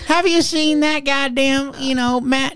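high, slow cartoon hillbilly drawl. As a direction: ham up a clueless small-town local. Mocking small-town Rifle locals.

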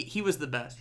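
normal talking voice

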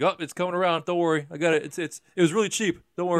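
high mock reassuring dad voice; a corny dad brushing off the dying boat; imitating the dad. Dad to his family about the dying boat, mock reassuring. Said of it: "and he's like".

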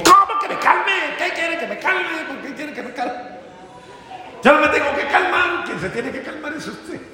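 high, incredulous mock anger; indignant outburst. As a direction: play the offended partner who refuses to calm down. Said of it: "en medio de la rabia".

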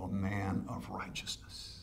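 hushed, slow whisper. Acted. Preacher to congregation, hushed whisper. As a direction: drop to a breath. Sermon drops to hush.

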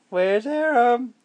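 slow, drawn-out high question; slow teasing baby talk. Acted. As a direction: stretch it out to build suspense. Peekaboo game with baby.

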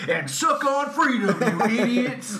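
mock shout. Mock patriotic rant in podcast.

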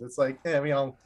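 ordinary talk

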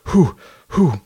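two loud, hard blown breaths; acted heavy "whew" exhale; queasy puffing. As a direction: blow out hard to hold nausea back. Acting out breaths before throwing up.

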